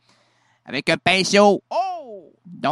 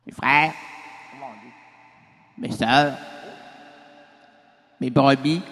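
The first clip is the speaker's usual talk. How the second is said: ton de sermon; 5 semitones lower than usual. Acted. Oh mon Dieu! chapitre premier.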